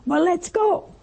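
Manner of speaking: high, eager retold exclamation; excited. Preacher voicing man thrilled by heaven, excited. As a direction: act out someone raring to go. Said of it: "he was so excited".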